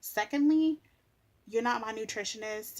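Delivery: normal talking voice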